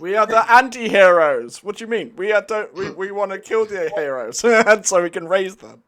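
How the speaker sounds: loud, laughing and excited. Real, not acted. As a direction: burst out laughing mid-line. Excited villain banter.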